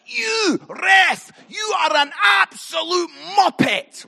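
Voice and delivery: high, loud yell at a referee; retold angry shout. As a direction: bellow it across the pitch. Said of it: "I shouted at him".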